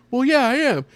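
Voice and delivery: imitated comeback. Acted quarrel from the show.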